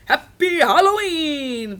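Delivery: loud, high, drawn-out clown cheer. A narrator's reading. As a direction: call out slowly like a clown. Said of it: "the clown sounded just like Missus Wright".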